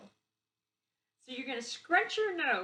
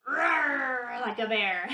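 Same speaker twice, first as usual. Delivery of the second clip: loud playful roar, louder through the phrase; bear roar; 2 semitones higher, 10 dB louder, much slower than usual. Shown as a demonstration. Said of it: "And then you can say".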